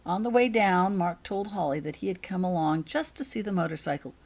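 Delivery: usual speaking voice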